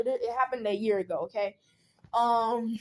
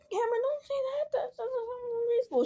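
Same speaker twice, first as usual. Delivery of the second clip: very high, whiny put-on scolding; mock wailing aunt; 13 semitones higher than usual. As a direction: imitate a tearful, squeaky reproach.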